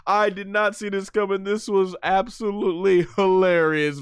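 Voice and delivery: high, loud, laughing while talking; laughing hard. Real, not acted. Streamer cracking up over Vegeta's reaction, high and laughing. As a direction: crack up through the words. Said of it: "laughing out loud like this".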